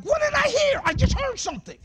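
high, loud startled surprise. Retold or imitated. Preacher acting startled at hearing God, high and loud. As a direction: jump at a sudden sound. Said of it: "I was like".

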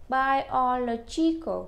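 Biological spelled out slowly with the LO syllable stressed; slow, careful model of Biological, push the LO syllable. Shown as a demonstration. Teacher drilling elderly students on Biological, slow and stressed. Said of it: "nhấn cái trọng âm ở chính giữa ngay chỗ chữ lô".

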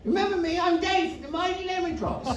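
put-on high, soft old-man voice; old English fan. Old fan reminding Steve who he is, high and soft. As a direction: chirp it like an eager old fan.

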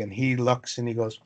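ordinary talk, nothing marked

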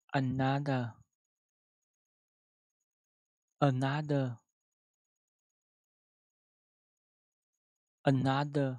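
loud, careful another; three syllables. Shown as a demonstration. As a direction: spell out another in three beats. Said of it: "Ba âm nha".

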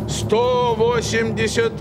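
ordinary talk, nothing marked

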